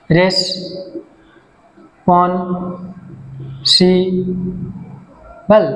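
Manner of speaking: Responsible split into four slow syllables. Shown as a demonstration. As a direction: say responsible one syllable at a time, long gaps between. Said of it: "চারটা syllable আছে".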